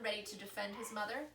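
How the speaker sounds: ordinary delivery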